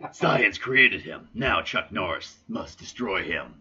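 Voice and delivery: low, slow trailer narrator; deep dramatic movie trailer read; movie announcer voice. Acted. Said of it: "I'm gonna be doing it in movie announcer voice".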